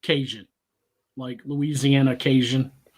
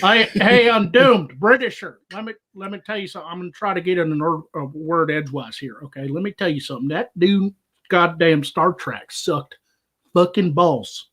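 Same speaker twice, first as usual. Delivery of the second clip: put-on rambling tipsy Southern good ol' boy; drunk Southern accent; 3 semitones higher than usual. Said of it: "My Southern accent gets heavier when I'm either drunk".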